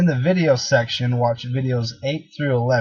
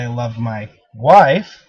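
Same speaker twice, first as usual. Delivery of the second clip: pause, then punch "wife" hard; stress on WIFE; 2 semitones lower, 5 dB louder, slower than usual. Shown as a demonstration. Trainer showing how emphasis changes meaning, stress on "wife". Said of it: "every time you emphasize on a different word".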